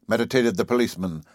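usual speaking voice

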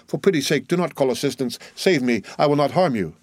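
ordinary delivery

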